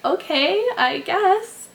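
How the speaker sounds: startled "okay, I guess"; surprised agreement. Retold or imitated. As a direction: caught off guard, agreeing. Retells own reply to her.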